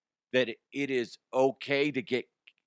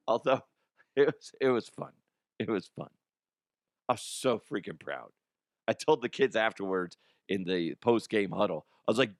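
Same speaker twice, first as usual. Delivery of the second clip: ordinary talk, nothing marked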